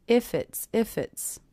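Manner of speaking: "If it's" joined, F running into the vowel; "If it's" as one word, no gap; linked words. Shown as a demonstration. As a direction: slide from the F of "If" into "it's". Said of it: "hear those connecting together".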